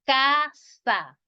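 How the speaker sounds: Casa with a strong, drawn-out CA; stress on first syllable. Shown as a demonstration. Teacher models the accent for students, Casa stressed on CA. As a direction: punch CA and let sa drop in Casa. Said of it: "tiene el acento en la K".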